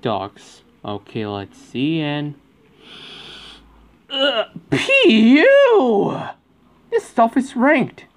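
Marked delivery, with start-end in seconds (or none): 4.1-8.1 high, loud, drawn-out cry of disgust; slow, high cry of disgust. Pretend. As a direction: recoil from the stink. Disgusted by old food.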